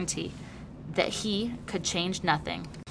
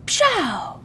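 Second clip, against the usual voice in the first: high, sharp puff of a gunshot; mock firing noise; 8 semitones higher, 5 dB louder than usual. A narrator's reading. A child fires an imaginary rifle, mock bang. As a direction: spit out a fake shot. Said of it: "with a firing noise".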